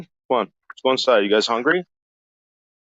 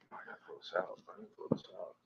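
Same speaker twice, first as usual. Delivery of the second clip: breathy unvoiced whisper; 4 semitones lower, 26 dB quieter, much faster than usual. Real, not acted. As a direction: whisper it to yourself. Whispered to self.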